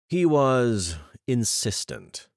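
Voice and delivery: understated bitter remark; a hint of bitterness. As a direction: let a little bitterness creep in. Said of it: "a hint of bitterness".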